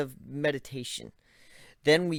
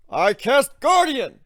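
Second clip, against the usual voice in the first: loud, high, slow battle cry; shouted spell; 13 semitones higher, 12 dB louder, slower than usual. Acted. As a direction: bellow the spell proudly. Ivan shouts his spell.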